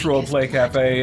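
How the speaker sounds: usual speaking voice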